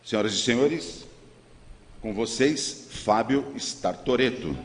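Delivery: grand entrance announcement. Real, not acted. Host introduces speaker on stage.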